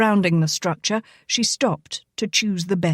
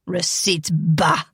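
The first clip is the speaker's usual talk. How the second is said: scoffing; 2 semitones lower, slower than usual. Acted. Frenchman scoffs.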